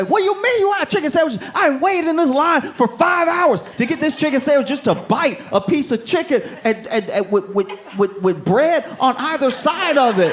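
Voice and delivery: high-pitched, frantic indignation; outraged customer. Acted. Customer complaining after hours in line, outraged. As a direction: act out a customer losing it, voice shooting way up. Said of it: "people were losing their minds over it".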